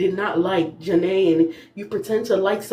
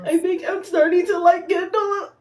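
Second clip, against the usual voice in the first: mock Garby quote; 9 semitones higher than usual. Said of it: "Today she said".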